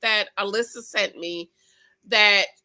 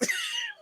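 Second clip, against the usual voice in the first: high giggle; 7 dB quieter than usual. Real, not acted. Laugh after gossip.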